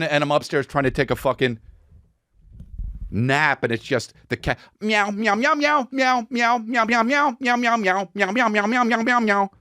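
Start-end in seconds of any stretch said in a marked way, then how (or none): 4.8-9.5 very high cat meows; high kitty meows; high cartoon meowing. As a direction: meow like a cartoon kitten. Imitating cat video.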